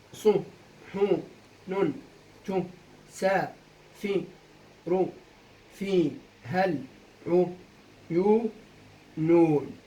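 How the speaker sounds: isolated syllables, evenly spaced with pauses; syllable-by-syllable scansion. Shown as a demonstration. Teacher scanning a second verse for students, syllable by syllable. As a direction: dictate the verse one beat at a time. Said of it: "هسا أول سؤال كالعادة قطع".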